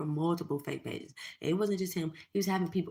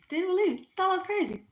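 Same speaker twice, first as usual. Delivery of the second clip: gleeful gloating; 13 semitones higher, 6 dB louder than usual. Retold or imitated. Voicing husband's vindication.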